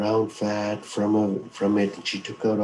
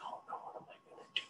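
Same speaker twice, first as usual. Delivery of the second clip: hushed, frightened whisper; scared whisper; 20 dB quieter, much faster than usual. A narrator's reading. Mink hides from the creature outside, frightened whisper. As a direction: whisper it as if hiding.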